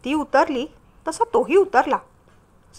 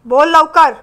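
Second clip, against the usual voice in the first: loud, curt snap; snapping; 3 semitones higher, 9 dB louder, slower than usual. A narrator's reading. Husband on the phone snapping at his wife, loud and curt. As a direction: bark it out impatiently. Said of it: "खेकसल्याचा आवाज".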